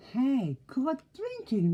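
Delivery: high, slow pig voice; high piggy voice. Acted. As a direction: squeal it like a happy pig.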